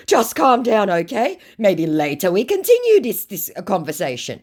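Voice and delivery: high, loud start, easing down; mock placating scammer. Acts scammer; later read back plain.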